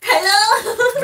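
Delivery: high, loud, rising hello; exaggerated high-pitched questioning hello. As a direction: call out in a put-on high voice. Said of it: "it's like a high-pitched voice".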